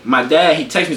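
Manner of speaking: ordinary delivery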